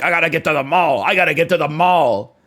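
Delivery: loud, hurried mock panic; hammed-up rushing, repeated insistently; mock urgency. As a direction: imitate someone frantic to get there, pushing it out loud. Mocks someone rushing to the mall.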